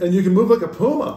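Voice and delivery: high, loud hammed-up dad; pleading dad. Acting the dad urging his son.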